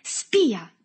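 loud, high burst; accusing shout. A narrator's reading. Berto accuses Agostino to his face, shouting. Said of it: "gridò Berto in faccia ad Agostino".

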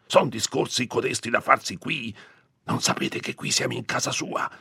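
high, strained scolding; urgent reprimand, pressed close; cried into an ear. A narrator's reading. Priest reminding Agnese whose house this is, urgent scolding. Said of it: "le gridò in un orecchio".

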